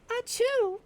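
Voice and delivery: real, very high, dainty sneeze; high-pitched sneeze. Real, not acted. Streamer sneezes and gets teased for how it sounds, high-pitched sneeze. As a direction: let out a squeaky little achoo. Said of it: "That's just how it sounds".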